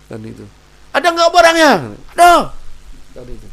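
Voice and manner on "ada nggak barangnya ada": high, very loud yelled question and answer; shouted to be heard over noise; market shouting. Acted. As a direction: holler it like haggling in a busy market. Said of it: "Kalau suaranya pelan ya nggak mungkin kedengaran".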